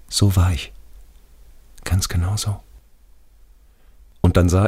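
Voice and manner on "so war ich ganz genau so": usual speaking voice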